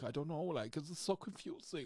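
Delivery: comic put-on German accent. Actor quotes his outtake, confused German accent. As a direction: muddle through in a mock German accent. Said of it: "I did this whole German thing".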